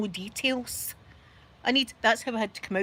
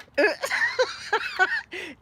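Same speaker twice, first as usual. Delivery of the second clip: very high laughing fit; real high-pitched cackle; helpless laughter. Real, not acted. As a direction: crack up until you can't move. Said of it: "I couldn't move for laughing".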